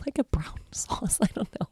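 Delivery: a breathy, laughing whisper. Real, not acted. Laughing at herself describing the sauce, whispered. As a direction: laugh the words out under your breath. Laughing at her own vague words.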